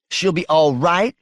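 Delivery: high, rising, disbelieving question; incredulous echo. Acted. Wife challenging husband's assurance about her mama, incredulous. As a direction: throw it back at him in disbelief.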